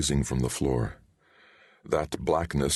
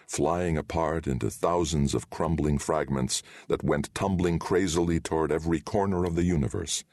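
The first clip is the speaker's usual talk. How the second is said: plain reading voice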